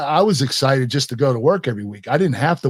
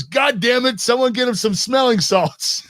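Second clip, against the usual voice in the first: much higher, exasperated mock yell; boss's exasperated outburst; 10 semitones higher than usual. Vince losing patience with the concussed wrestler, mock yell. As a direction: play the fed-up boss barking orders. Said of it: "And Vince goes".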